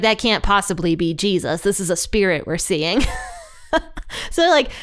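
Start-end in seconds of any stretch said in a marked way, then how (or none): none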